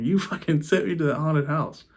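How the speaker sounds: acting out an indignant outburst; pissed off. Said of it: "I'm pissed off".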